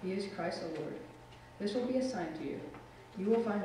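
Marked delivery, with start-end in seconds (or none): none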